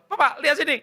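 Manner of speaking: put-on high, loud, proud kid voice; child calling Dad. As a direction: call Dad over to see. Acted child calling father to look. (suara anak memanggil papanya, tinggi)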